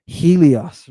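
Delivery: Helios as "HEE-lee-os"; English pronunciation. Shown as a demonstration. Teacher giving the class the English form, Helios as "HEE-lee-os". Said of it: "I guess you say in English".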